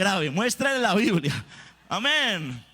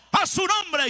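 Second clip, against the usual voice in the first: shouted order, much higher; military call-out; 10 semitones higher than usual. Retold or imitated. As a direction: call it out like a commander.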